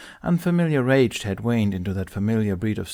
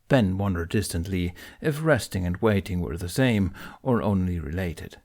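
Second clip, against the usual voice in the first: ordinary delivery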